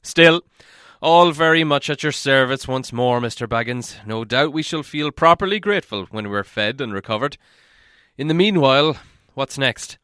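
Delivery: a lightly voiced grumbling dwarf; hint of a put-upon dwarf; gruff dwarf voice. A narrator's reading. Dwarf speaking, held figure.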